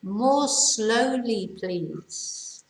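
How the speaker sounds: slowly. Shown as a demonstration. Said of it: "Más despacio, por favor".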